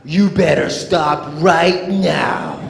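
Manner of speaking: slow, loud bossy warning; mock stern threat. Shown as a demonstration. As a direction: play the too-harsh way, laughing. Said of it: "You mean like this?".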